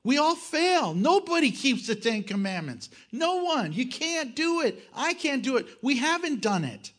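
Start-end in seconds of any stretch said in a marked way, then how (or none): none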